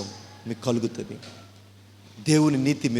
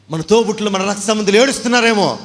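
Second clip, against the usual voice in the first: higher, louder lament; impassioned preaching; 9 semitones higher, 8 dB louder than usual. Acted. Preacher mourning lost relatives, loud lament. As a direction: cry it out for the lost.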